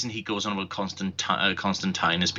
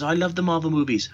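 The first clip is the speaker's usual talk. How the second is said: higher, quick mock gush; mocking Henry; 9 semitones higher, faster than usual. Mocking Henry who loves Marvel movies, higher gushing voice. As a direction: parody a gushing movie fan. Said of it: "Henry says".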